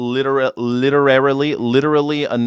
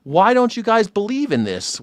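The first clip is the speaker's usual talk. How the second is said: usual speaking voice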